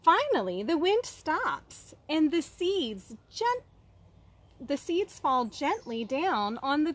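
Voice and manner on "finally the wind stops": ordinary delivery, nothing marked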